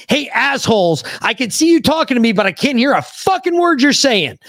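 frustrated deafened soldier yelling way up high; retold scream. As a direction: shout it at the guys who can't hear you. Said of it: "I just finally screamed out".

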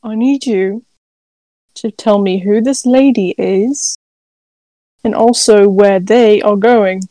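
slow, menacing demand; intimidating. Acted. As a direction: lean in and threaten slowly. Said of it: "I'm trying to be intimidating".

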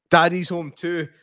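usual speaking voice

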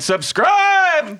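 high excited shout Subscribe. Acted. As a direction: yell it out to the listeners. Sign-off call to listeners.

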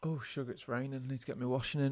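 distant, very quiet aside; far from mic. Real, not acted. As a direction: speak away from the mic.

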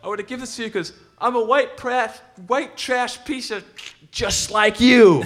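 high put-on voice of a worked-up fan, slowing at the end. Fan blurting his insult to the singer in a high mock voice. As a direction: ham up the drunk fan's big punchline. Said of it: "gets more and more worked up, and finally goes".

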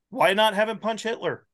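high-pitched, worked-up protest; exasperated plea. Real, not acted. Arguing that movies should take a risk, exasperated plea. Riled plea for bolder films.

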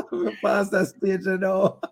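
talking through laughter; laughing. Real, not acted. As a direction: laugh while you say it. Laughing while he jokes.

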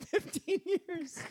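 slow, high-pitched words breaking into laughter; cracking up while answering; helpless laughter. Real, not acted. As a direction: squeal it out through real laughing. Laughing admission after accent teasing.

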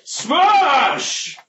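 very high, loud smash roar; Hulk roar. Acted. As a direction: bellow like a monster. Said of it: "I'm gonna be Hulk".

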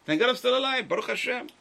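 lifts it high and loud in thanks; joyful exclamation. Retold or imitated. Rabbi voicing a grateful survivor, joyful and loud. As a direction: cry out your gratitude like a survivor.

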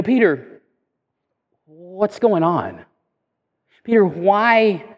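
slow, gentle, open inquiry; honest question. Acted. Preacher voices Jesus sincerely asking Peter, honest question. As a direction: ask sincerely, with long pauses. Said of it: "it's just simply an honest question".

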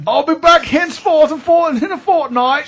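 high, loud mock Cockney chimney sweep. Teasing the dad as a chimney sweep, high mock Cockney. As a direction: ham up a Mary Poppins sweep. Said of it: "You're a chimney sweep all of a sudden".

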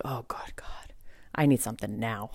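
breathless whisper to God; retold whisper of despair; whispered plea. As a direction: whisper it like a desperate prayer. Pleading for help right now.

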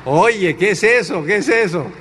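sharp, rising mock alarm; indignant wife. Wife questioning her husband, indignant. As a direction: demand an explanation, pitch jumping about. Wife reacts on scanning him.

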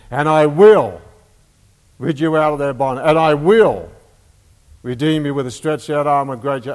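scripture read with every "I will" punched loud, pausing after; stressing each I will. Shown as a demonstration. Preacher reading God's promises aloud, leaning hard on "I will". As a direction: hammer each "I will", then let the rest run on. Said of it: "So let me just emphasize that".

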